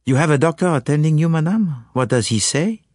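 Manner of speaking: calm Belgian sleuth probing gently; Poirot. Acted. Poirot questions his anxious client, calm and probing.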